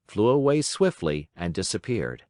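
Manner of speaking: ordinary delivery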